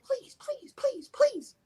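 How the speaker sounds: high, pleading please over and over; mock high-pitched begging; imitating pleading woman. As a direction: plead in her high little voice. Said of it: "She's like".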